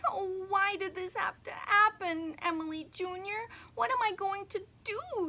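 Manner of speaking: high, loud, tearful wail; a high child's voice, sobbing; crying. Acted. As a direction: cry it out to the doll. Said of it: "she cried".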